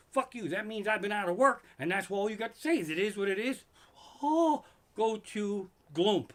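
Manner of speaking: comic outburst. Acted. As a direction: blow up at the useless phrase.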